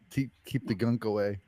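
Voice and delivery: ordinary delivery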